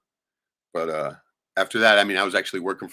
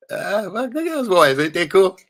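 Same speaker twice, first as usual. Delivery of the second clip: excited pride, much higher and louder; 11 semitones higher, 8 dB louder than usual. Real, not acted. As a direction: gush over the photo. Proudly showing nephews' photo.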